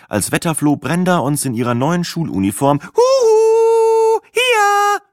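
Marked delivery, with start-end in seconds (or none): 2.8-5.0 very high, loud sing-song call; a bright high warble of hello; trilled greeting. A narrator's reading. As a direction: trill it across the room to friends. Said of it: "zuträllerte".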